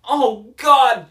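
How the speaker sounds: loud, high melodramatic cry; hammed-up burst of horror; mock anguished outcry. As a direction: cry out in parody of grief. Re-enacting the grief scene again.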